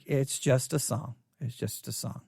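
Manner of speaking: flat, unimpressed brush-off; bored dismissal. Retold or imitated. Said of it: "everybody's like".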